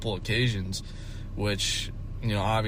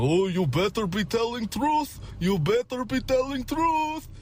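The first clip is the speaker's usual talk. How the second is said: high mock Russian accent, second line slower; Russian boss warning; 13 semitones higher than usual. Boss warning me to tell the truth, high mock Russian accent. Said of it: "And they're like".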